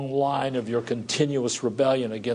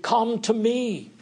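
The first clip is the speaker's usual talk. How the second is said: tender plea; 8 semitones higher, slower than usual. Acted. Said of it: "And he says".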